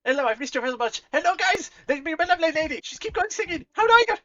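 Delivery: high, loud, gushing tavern host; excited beholder tavernkeeper. Acted. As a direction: greet them like an overjoyed innkeeper. GM voices tavern keeper beholder.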